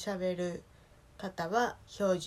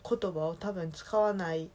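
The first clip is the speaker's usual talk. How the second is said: ordinary talk, nothing marked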